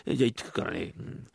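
ordinary talk

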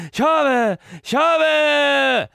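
high, loud amateur "勝負！"; clumsy stage shout. Pretend. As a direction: bellow it like a bad actor. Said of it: "下手くそだな". (下手な芝居の大声)

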